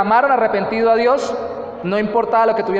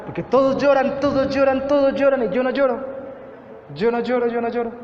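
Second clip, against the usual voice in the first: cousin's voice; 5 semitones higher than usual. Retold or imitated.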